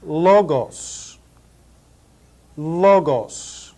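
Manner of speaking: Logos as LO-goss, short o; Logos, careful teacher demo; Greek pronunciation. Shown as a demonstration. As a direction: Logos with clear Greek o vowels. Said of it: "Greek vocabulary drill".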